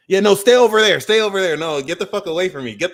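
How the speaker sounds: high, loud get-away voice; hammed-up keep-away yell; mock shoo-away. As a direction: shoo them off in mock alarm. Acting out pushing people away.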